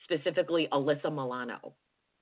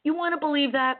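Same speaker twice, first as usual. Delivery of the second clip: much higher, louder, exasperated "whatever" tone; fed-up dismissal; 13 semitones higher, 4 dB louder than usual. Retold or imitated. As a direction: throw up your hands and let it go, mockingly. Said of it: "throw up your hands and say".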